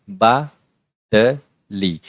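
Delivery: बादली drawn out very slowly, each syllable clear; Marathi word demo. Shown as a demonstration. Teacher modelling the Marathi word for learners, very slow. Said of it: "यहाँ पर उसका उच्चारण है".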